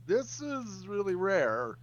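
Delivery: high, slow, drawn-out mock awe; lightly mocking, slow and high; mock impressed. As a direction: stretch it out like a merchant showing off. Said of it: "It's like".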